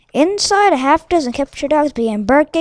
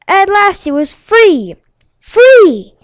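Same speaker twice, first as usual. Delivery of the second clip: louder, higher cry of joy; joyful shout; 6 semitones higher, 7 dB louder, much slower than usual. A narrator's reading. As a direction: shout it with delight. Joyful escape.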